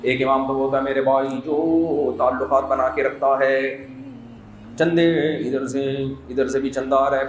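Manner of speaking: normal talking voice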